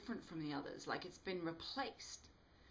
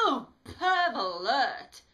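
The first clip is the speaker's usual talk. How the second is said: loud, slow, much higher mock-outraged cry; shouting in disgust; 7 semitones higher, 14 dB louder, much slower than usual. A narrator's reading. Alice shouting at the flirting pair in disgust. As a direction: drag it out, loud and appalled. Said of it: "shouts Alice".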